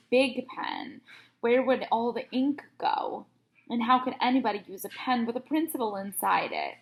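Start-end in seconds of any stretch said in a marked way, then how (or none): none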